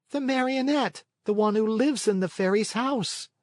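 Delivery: answering up to the window; puppet boy. A narrator's reading. Pinocchio explaining.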